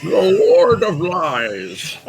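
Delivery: very slow, loud, high proclamation; hammed-up character voice, stretching every word; Deckard Cain. Guest joins in the game-character impression, grandly drawn out. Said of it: "throw a little Deckard Cain".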